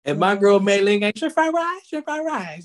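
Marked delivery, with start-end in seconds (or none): 1.2-1.9 high, clipped, sing-song calling of the order, ending on a rise; imitating the carryout cashier. Mimicking the carryout cashier to his podcast friend, high call. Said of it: "That's how they talk". 1.9-2.6 the same high mimicry, softer and ending on a fall; imitating the carryout cashier. Mimicking the cashier a second time to his friend, softer high repeat. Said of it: "That's how they talk".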